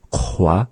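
"kwa" from the back with rounded lips. Shown as a demonstration. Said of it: "we round out the mouth".

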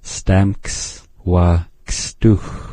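Stamks wa kstuch said very slowly, syllable by syllable; teacher slowing right down; slow demo. Shown as a demonstration. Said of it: "this K S sound is a little bit tricky".

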